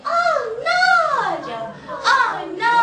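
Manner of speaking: high, loud alarmed bird; mock-panicked squeal; bird in crisis. Shown as a demonstration. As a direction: cry out as a little bird in danger. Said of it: "얘는 이제 위기에 처한 새".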